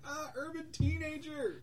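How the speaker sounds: high, drawn-out frightened lady; hammed-up cry of terror; mock scream. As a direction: wail it in pretend horror. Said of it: "one lady screams".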